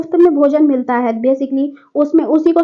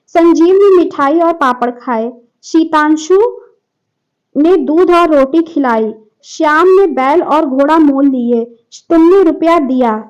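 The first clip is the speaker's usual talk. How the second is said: louder, slow read of the question; reading aloud; 2 semitones higher, 6 dB louder, slower than usual. Shown as a demonstration. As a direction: read each sentence out slowly and firmly for the class. Teacher reads test sentences aloud. (कक्षा को प्रश्न के वाक्य ज़ोर से, धीरे पढ़कर सुनाना)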